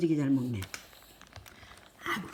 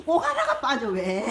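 laughing; 9 semitones higher than usual. Real, not acted. A blueberry falls out.

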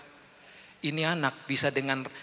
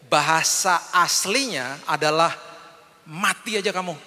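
rising preaching; 5 semitones higher, 5 dB louder than usual. Real, not acted. Preacher to congregation, building to a forceful climax. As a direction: build the sermon point to a forceful peak.

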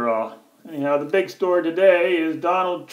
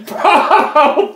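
laughing scorn; 9 semitones higher, 4 dB louder than usual. Real, not acted. Laughing at Bravo.

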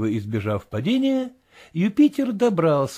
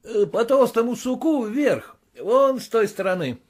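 higher, eager master calling up; Legrand's voice; 7 semitones higher than usual. A narrator's reading. Master calls directions up the tree. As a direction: call up the tree to him. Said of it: "ответил Легран".

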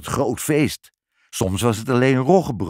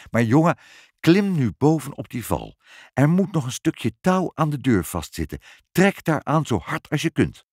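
higher, insistent instructions; urgent father; 6 semitones higher than usual. A narrator's reading. Trapped father telling his boy to pull, urgent. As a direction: urge him on from inside the trap.

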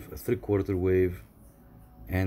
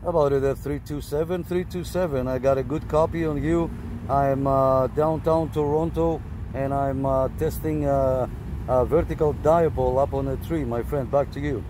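raised, higher voice calling out over the radio; projecting into the mic to reach a distant operator; 8 semitones higher, 4 dB louder than usual. Real, not acted. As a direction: call out to whoever's listening, loud and high. Answering contact on the radio.

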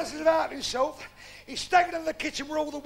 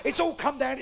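ordinary talk, nothing marked

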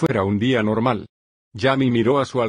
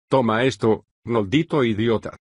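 usual speaking voice

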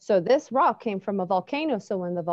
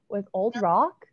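ordinary delivery

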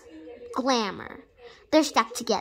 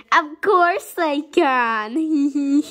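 slow, high gloating doctor; evil doctor voice; 3 semitones higher, 6 dB louder, much slower than usual. Pretend.

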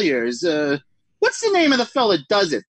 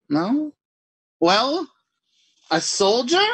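ordinary delivery

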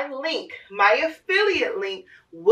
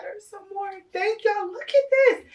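excited squeal; 10 semitones higher than usual. Real, not acted. Thrilled at daughter's gifts.

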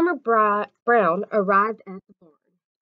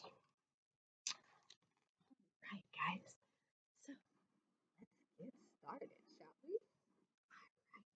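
whispered aside to listeners; soft unvoiced whisper; 27 dB quieter, faster than usual. Real, not acted. Quiet whisper to viewers.

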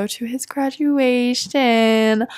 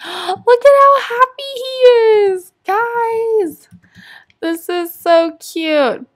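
high, slow gush of delight; gushing squeal; 11 semitones higher, 4 dB louder, much slower than usual. Real, not acted. Vlogger gushing to viewers over a happy sim, high squeal.

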